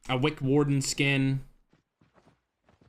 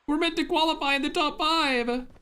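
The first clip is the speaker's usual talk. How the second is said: much higher, squeaky imitated outrage at a teammate; mock furious rant; 12 semitones higher, slower than usual. Caster imitating a player raging at his teammate, high mock fury. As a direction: play the panicked teammate swearing at him. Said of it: "I'd be like".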